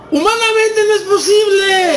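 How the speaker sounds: high, drawn-out exclamation of disbelief; incredulous outcry. Acted. Preacher voices human doubt to the congregation, incredulous outcry. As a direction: cry it out slowly, like a doubter who can't believe it.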